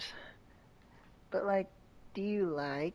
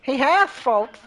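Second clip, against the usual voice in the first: high, loud host voice; news reporter voice; 6 semitones higher, 10 dB louder, slower than usual. Acted. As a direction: address the folks at home. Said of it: "news reporter voice".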